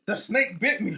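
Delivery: whiny complaint. Retold or imitated. Mock complaint about snake bite.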